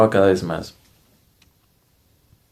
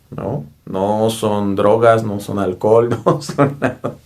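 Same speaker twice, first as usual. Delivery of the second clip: ordinary talk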